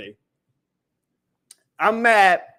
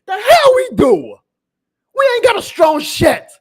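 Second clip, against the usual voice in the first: much higher and louder, slow, angry yell; furious shout; 13 semitones higher, 8 dB louder than usual. Real, not acted. Fan yelling back at the GM's claim, furious shout. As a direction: bellow it back at him in disbelief.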